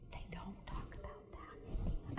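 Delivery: hushed, breathy whisper; a kid's secretive whisper; whispered aside. Retold or imitated. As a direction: whisper it so the table can't hear. Son quietly warning her at table.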